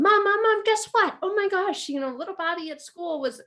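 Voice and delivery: put-on high, loud, gushing child calling mom; a kid tugging at mom's sleeve, high and breathless; excited kid. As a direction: play the eager kid bursting with news.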